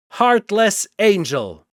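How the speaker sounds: angry shout. Acted. Wolverine shouting at Sephiroth, slow and loud. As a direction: hurl the insult.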